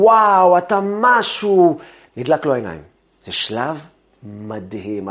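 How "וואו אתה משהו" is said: wowed praise. Retold or imitated. Everyone praising singing brother.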